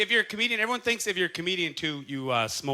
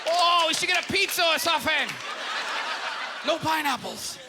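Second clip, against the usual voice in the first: a bad put-on of a giddy stoned friend; bad impression of Hideki; 10 semitones higher than usual. Comic mimics a stoned fan after the show, bad high impression. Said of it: "That's a bad impression".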